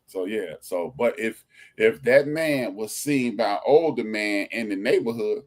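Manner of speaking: ordinary talk, nothing marked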